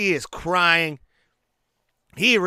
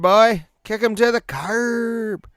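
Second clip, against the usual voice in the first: mock sass; 7 semitones higher, 5 dB louder than usual. Mock dismissal.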